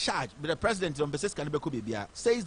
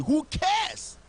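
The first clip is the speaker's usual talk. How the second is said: high, loud scornful "who cares"; dismissive shout; 10 semitones higher, 4 dB louder than usual. Real, not acted. Radio host scoffing at the EIU poll, loud and dismissive.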